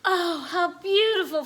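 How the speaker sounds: firefly enchanted by colors; enchanted gasp. Acted. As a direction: breathe it out in wonder. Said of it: "Firenze grasped".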